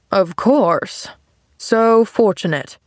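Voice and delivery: slow, disdainful drawl; sniffy sarcasm. A narrator's reading. Man scorning girl's glad talk, sniffy sarcasm. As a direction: draw it out with scornful irony. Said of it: "sniffed the man".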